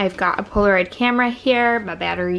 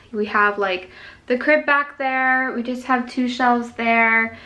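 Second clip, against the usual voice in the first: ordinary talk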